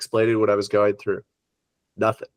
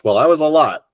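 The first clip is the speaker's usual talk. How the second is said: normal talking voice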